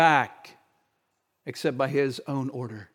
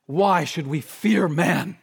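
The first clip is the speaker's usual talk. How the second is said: normal talking voice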